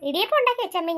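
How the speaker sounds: ordinary talk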